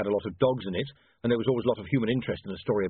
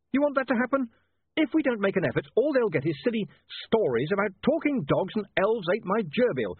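heated outburst; 7 semitones higher than usual. A narrator's reading. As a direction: build to an angry demand.

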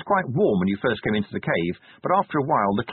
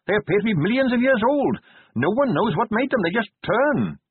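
higher, keen and sincere; earnest young captain; 9 semitones higher than usual. Acted. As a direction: explain it eagerly to his lord. Carrot voiced, earnest young captain.

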